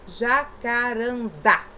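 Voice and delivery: jacarandá drawn out slowly, last syllable "dá" forced; stress on final syllable. Shown as a demonstration. Teacher showing pupils the strong syllable, stressed "dá" in jacarandá. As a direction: lean hard on the "dá" ending jacarandá. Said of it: "já força a sílaba".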